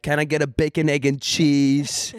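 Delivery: full-on hammed-up New York accent; New Yorker accent. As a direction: order like a New Yorker, stretching the ending. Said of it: "like, a New Yorker accent".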